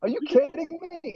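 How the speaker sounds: high, astonished squeak; floored that girls want an autograph; disbelief. Retold or imitated. Young singer asked for an autograph, high astonished disbelief. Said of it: "I'm like".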